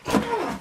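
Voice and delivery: bitten-off curse, high and sudden; cut-off swear. Real, not acted. Vlogger stopping a swear word on camera, cut-off curse. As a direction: stop yourself mid-swear. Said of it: "I can't swear".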